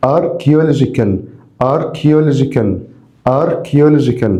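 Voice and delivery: Archaeological spoken slowly with ch as a hard k, ar-kee-o-LO-ji-kal. Shown as a demonstration. Teacher drilling students on Archaeological, slow with a hard k. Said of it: "خلي بالك هنا السي اتش أنطقها كي".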